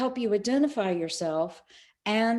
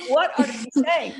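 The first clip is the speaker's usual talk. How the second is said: surprised; 5 semitones higher, 5 dB louder than usual. Retold or imitated. Daughter's surprised reply.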